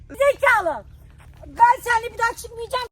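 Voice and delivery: loud, exasperated tirade; angry shouting. Real, not acted. Victim swearing off outings with his friend, angry shout. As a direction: yell insults at the prankster. Furious at the prankster.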